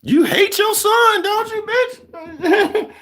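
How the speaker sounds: high, slow shout breaking into laughter; hammed-up accusing shriek; mock outraged yell. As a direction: scream it at the mother, laughing. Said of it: "you would be like".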